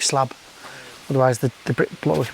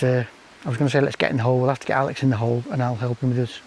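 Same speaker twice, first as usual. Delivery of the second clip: normal talking voice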